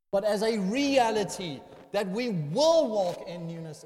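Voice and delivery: REALITY and WILL punched loud; forceful pulpit stress on "reality" and "will"; emphatic contrast. Real, not acted. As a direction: hit "reality" and "will" hard as certainties.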